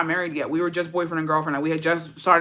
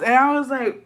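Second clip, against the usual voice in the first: ordinary delivery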